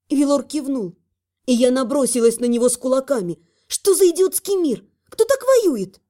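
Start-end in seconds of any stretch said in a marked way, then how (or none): 3.7-6.0 high, loud, furious disbelief; indignant outburst. A narrator's reading. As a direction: rage at the absurd war. Heroine attacks him with fists.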